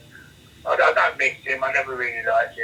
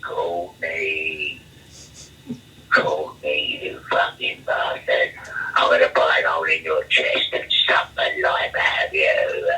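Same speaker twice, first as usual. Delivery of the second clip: very low, slow, drawn-out threat; menacing prisoner's call; 11 semitones lower, much slower than usual. Retold or imitated. Prisoner threatening the new arrival from his cell, low menacing impression. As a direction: impersonate a menacing inmate calling through the night. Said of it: "and I heard, "Courtney".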